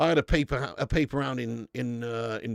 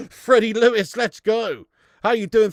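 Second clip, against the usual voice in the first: much louder, higher, hyped welcome; excited shout-out; 5 semitones higher, 9 dB louder than usual. Real, not acted. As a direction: cheer the new member's name. Excited greeting to a new member.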